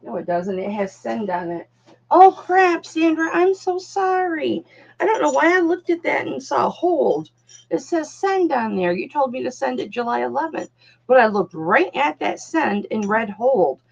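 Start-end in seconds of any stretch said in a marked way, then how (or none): 2.1-8.9 high-pitched, real dismay at a mistake; dismayed apology. Real, not acted. Apologizing to customer Sandra for misreading, high dismay. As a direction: burst out high, then apologize profusely.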